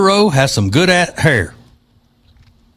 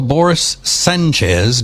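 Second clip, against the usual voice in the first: ordinary talk, nothing marked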